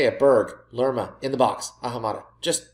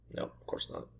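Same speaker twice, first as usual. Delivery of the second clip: resigned whisper; 18 dB quieter than usual. Real, not acted. Chance missed again.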